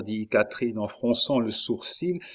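ordinary delivery, nothing marked

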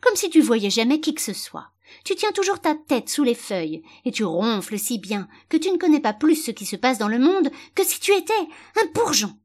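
scornful, heated dressing-down; exclaimed rebuke. A narrator's reading. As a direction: berate the ignorant little flower, building to a high finish. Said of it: "s'exclama le lys tigré".

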